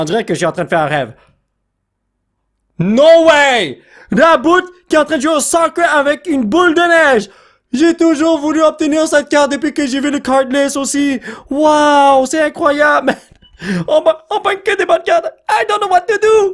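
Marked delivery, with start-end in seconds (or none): 2.8-7.3 high, loud cry of disbelief; amazed shout. Real, not acted. Card opener seeing a rare card, amazed shout. 7.7-16.5 high-pitched gush of amazement; overwhelmed excitement. Real, not acted. Collector getting a long-wanted card, overwhelmed high squeal.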